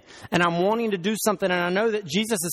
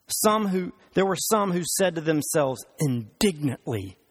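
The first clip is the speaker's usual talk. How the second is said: ordinary talk, nothing marked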